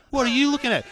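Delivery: mock tough guy, pitched high; high, challenging demand, lightly hammed up. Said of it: "Now the tough guys get on".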